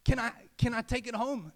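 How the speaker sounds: put-on boy begging the store owner for his boat; excited pleading; eager boy. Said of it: "he says to the store owner".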